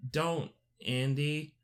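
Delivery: lightly voiced sweet doll; a hint of a cute toy doll pleading; talking doll. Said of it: "puts on the good guy doll voice".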